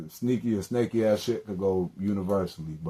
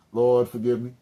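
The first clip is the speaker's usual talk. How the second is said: mock prayer; 4 semitones higher, 6 dB louder than usual. Real, not acted. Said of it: "let me not pray with a damn".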